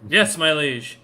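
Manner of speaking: loud, obedient acknowledgement; loud guard's reply. Acted. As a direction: answer your ruler at parade-ground volume. Shouting guard answers his liege.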